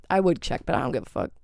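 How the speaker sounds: usual speaking voice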